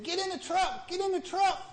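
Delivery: high panicked calling out; panicked yell. Acted. Buddy running across the field yelling to flee, high panic.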